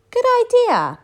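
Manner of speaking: very high, loud squeal of approval; big skeleton, delighted. A narrator's reading. Big skeleton agrees to the plan, in a high delighted squeal. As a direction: leap up in pitch with glee. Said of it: "the big skeleton said".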